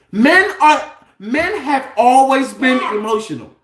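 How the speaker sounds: getting worked up and loud; too loud. Real, not acted. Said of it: "You're too loud".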